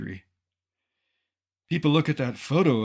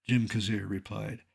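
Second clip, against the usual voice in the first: normal talking voice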